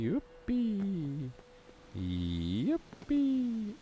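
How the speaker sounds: put-on very high, childish yippee; high squeaky cheer. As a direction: squeal it like a giddy kid.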